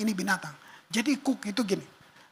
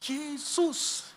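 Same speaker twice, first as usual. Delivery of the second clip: higher, softer "Yesus."; raised; 5 semitones higher, 4 dB quieter than usual. Shown as a demonstration. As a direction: call the name gently up high. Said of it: "siapa yang di sebelah lo". ("Yesus." lebih tinggi dan lembut)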